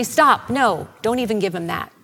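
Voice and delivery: loud, sharp order to stop; forceful objection. Retold or imitated. Crowd stops someone offering wine, loud objection. Crowd halting a kind gesture.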